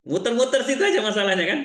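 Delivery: amused words through real laughter; laughing. Real, not acted. Speaker laughing to the audience at the couple's problem, laughing.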